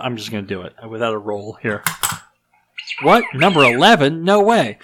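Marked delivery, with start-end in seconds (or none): 3.0-4.8 mock surprise. Real, not acted. Reads next question by accident.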